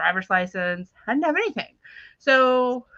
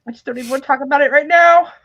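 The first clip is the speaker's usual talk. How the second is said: loud, fast, high outburst; retold exasperated outburst; 6 semitones higher, 10 dB louder, faster than usual. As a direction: imitate someone snapping and shutting down the talk. Acted quarrel with dad over keys.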